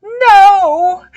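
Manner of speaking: high, loud cry of frustration; frustrated yell. Acted. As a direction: yell it out, losing the game. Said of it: "she yells in frustration".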